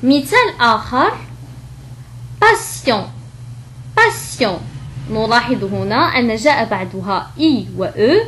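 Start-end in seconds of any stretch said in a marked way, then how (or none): 2.4-3.1 "باسيون" with s, then a nasal on; S sound. Shown as a demonstration. Teacher giving another example, s in "باسيون". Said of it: "فنطقت كحرف السين". 4.0-4.6 "باسيون" with s, then a nasal on; S sound. Shown as a demonstration. Teacher giving another example, s in "باسيون". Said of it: "فنطقت كحرف السين".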